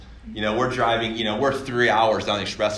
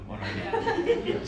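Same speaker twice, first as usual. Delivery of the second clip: high, slow, laughing repeat of the question; laughing panic; 4 dB quieter than usual. Retold or imitated. Frozen at the gas pump, laughing in helpless panic. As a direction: crack up while asking it again.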